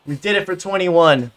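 normal talking voice

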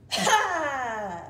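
loud forceful exhale, a big breathy ha; loud lion breath. Shown as a demonstration. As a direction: push all the air out in one loud ha. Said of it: "a lion's breath isn't screaming".

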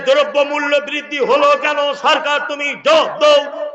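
high, level chanted demand; protest slogan. Acted. Protesters blocking the road over prices, chanted slogan. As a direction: call it out like a street agitator. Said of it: "রাস্তা অবরোধ করে বলছে".

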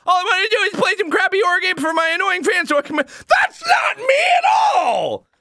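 high, indignant yelling; furious protest; outraged shout. Real, not acted. Outraged at fake Markiplier.

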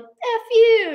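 high, loud dismissive jab; mock defiance. Litterers rebuffing a polite challenge, loud mock defiance. People snapping back, imitated.

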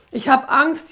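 high, loud frightened whine; a scared child pleading; whimpering. Acted. Amy tells the demon she is scared, high and whimpering. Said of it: "jammerte Amy".

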